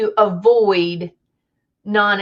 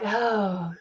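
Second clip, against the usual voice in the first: weary soft sigh of an oh; imitating exasperated officials, quietly; eye-rolling; 8 dB quieter than usual. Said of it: "C D C rolling their eyes".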